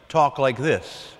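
ordinary talk, nothing marked

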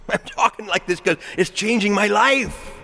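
high, ringing preacher's cry; impassioned retort. Retold or imitated. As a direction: push the pitch up and proclaim it with conviction.

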